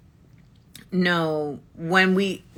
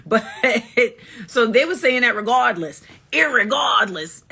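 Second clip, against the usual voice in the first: higher, amused, laughing through the words; 4 semitones higher, 3 dB louder than usual. Real, not acted. Amused at people who misheard her, laughing. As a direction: crack up while drawing out the word.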